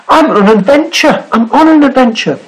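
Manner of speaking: high, eager little sailor voice; high rabbit voice. Acted. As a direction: squeak it out like a small rabbit. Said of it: "said the sailor".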